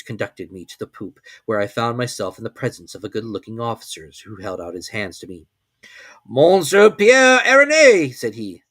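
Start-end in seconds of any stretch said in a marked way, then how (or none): 6.3-8.1 loud, slow, ceremonious naval greeting; commander's greeting. A narrator's reading. Ship's commander welcoming the professor aboard, loud slow greeting. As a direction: greet him grandly and slowly, in a raised voice.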